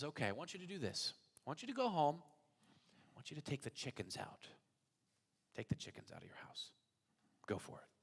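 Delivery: soft, calm instructions; a patient rabbi speaking quietly. Acted.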